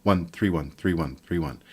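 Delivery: chanted count stressing one and three; accent one and three. Shown as a demonstration. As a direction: punch the first and third pulses. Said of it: "emphasis on the first and third".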